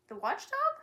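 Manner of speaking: high puzzled question; puzzled rising the watchdog; quizzical. Acted. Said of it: "said Milo quizzically".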